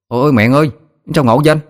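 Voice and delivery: high-pitched amazed exclamation; sudden exclamation. A narrator's reading. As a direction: cry out in wonder at a new taste. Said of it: "Chợt nó kêu lên".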